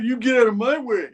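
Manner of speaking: high, affronted comeback; indignant mock retort. Man in a suit to runaway, high indignant retort. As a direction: throw it back in a high voice. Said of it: "this guy in a suit's like".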